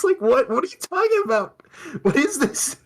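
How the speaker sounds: very high, squeaky mock panic; high mock-confused outburst; panicked squeak. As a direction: squeal it like a startled girl.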